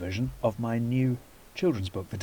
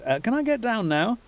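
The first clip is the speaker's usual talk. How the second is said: put-on uneasy girl; 9 semitones higher, 4 dB louder than usual. Lana held up uneasily.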